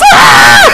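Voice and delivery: short startled shriek; sharp scared scream; high sudden scream. Real, not acted. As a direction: yelp in fright.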